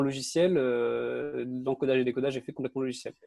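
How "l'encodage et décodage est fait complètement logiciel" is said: usual speaking voice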